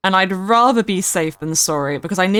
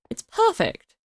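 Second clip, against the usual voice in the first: delighted; 11 semitones higher than usual. Real, not acted. Repeated with different tune.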